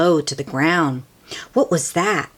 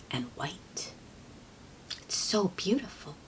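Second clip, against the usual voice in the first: whispered ending; hushed narration; 16 dB quieter than usual. A narrator's reading. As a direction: let it fade into a whisper.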